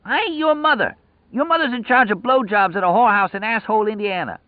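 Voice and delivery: high, furious tirade; enraged, high-voiced rant; screaming insults. A narrator's reading. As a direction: hurl abuse down the cell block at a high pitch. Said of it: "Lloyd screamed".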